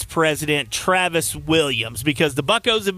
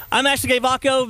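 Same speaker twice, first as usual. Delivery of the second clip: higher-pitched, quick ad sign-off; mock commercial, name run together; imitating rapid-fire name; 8 semitones higher than usual. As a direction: rattle off the name like a hurried radio spot. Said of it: "she would say it so fast".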